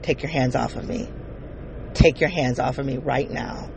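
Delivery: low, firm and quiet; a low, steady stern warning; stern command. Retold or imitated. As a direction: lay down the line sternly. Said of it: "I say very sternly".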